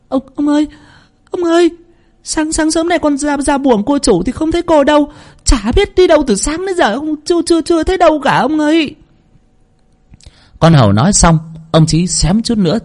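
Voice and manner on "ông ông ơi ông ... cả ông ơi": put-on much higher, stammering frightened servant girl; breathless stammering maid. Maid panicking to her master about the missing girl, stammering high. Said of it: "thở không ra hơi, cất giọng lắp bắp".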